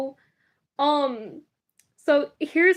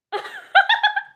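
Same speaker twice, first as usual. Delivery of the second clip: high laugh. Real, not acted. Laughs at her own mistake.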